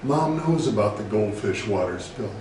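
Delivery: ordinary talk, nothing marked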